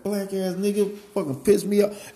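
high, strained mock rant of an angry friend; angry friend impression. Friend blaming someone for making him angry, high and ranting. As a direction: pitch it way up and spit it out like him. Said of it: "He's like".

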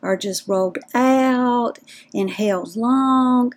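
stretched-out, rolled-out Southern drawl; slow Southern drawl. Shown as a demonstration. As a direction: draw out every word in a slow Southern drawl. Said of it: "if you're from the South that you talk slow".

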